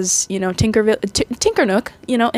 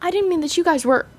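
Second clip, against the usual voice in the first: high, fast, flustered excuse; higher flustered backpedal; backpedaling Tinkerbell; 7 semitones higher, faster than usual. Retold or imitated. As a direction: hurry to take it back. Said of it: "she goes".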